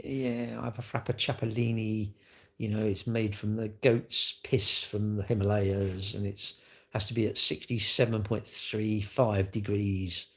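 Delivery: lightly voiced snooty fancy talker, soft and slow; a hint of a posh snob ordering; mock posh talk. Mocking viewers who talk fancy, soft put-on posh voice. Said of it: "you all talk fancy".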